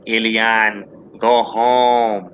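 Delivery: drawn-out alien movie voice. Acted. Mocking a politician as the alien on the bike, creature impression. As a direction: imitate a little alien, word by word. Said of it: "you like E T in the bike".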